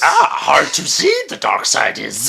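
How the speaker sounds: slow, odd Yoda voice. Pretend. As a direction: speak like a tiny wise alien master. Yoda impression.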